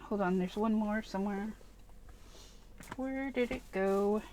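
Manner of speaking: soft, absent-minded searching aside; muttering to self. Real, not acted. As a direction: say it quietly to yourself while looking.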